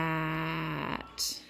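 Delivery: SAT with long held S and A; slow sounding-out. Shown as a demonstration. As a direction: stretch SAT out, short T at the end. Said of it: "they do it slow".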